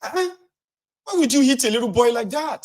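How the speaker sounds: high, indignant complaint; high mock indignation; scolding bystander. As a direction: scold the adult for hitting a child.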